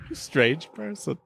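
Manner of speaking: ordinary talk, nothing marked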